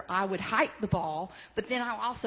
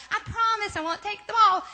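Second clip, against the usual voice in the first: high, louder sing-song promise; sweet Lucy promise; 10 semitones higher, 6 dB louder than usual. Retold or imitated. Lucy assuring Charlie Brown, high sing-song promise. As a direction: promise with fake innocence. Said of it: "Lucy answers".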